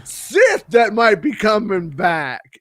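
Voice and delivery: high, drawn-out hammy character voice; theme park role player. Acted. Park actor pestering parent about the Sith, overdramatic voice. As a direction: overact the worry, high and slow. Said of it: "four accents during the course of it".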